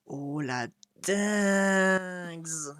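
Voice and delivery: slow, drawn-out amazement; awed drawl. Real, not acted. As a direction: stretch it out in awe. Gamer awed by cutscene.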